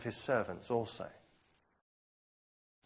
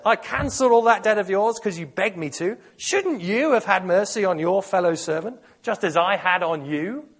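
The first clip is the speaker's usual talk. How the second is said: 1 angry rebuke; 8 semitones higher, 12 dB louder than usual. Acted. Master rebukes the servant.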